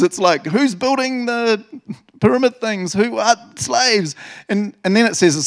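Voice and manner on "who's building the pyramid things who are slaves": high, loud, drawn-out mock disbelief; bewildered Egyptians. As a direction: act out comic outrage at missing workers. Acts out Egyptians missing their slaves.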